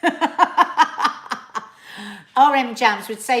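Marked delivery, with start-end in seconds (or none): 0.0-1.7 loud, high, long-held swear; drawn-out exclamation. Acted. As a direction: wail the swear word at full volume. Acting out a viewer's swear word.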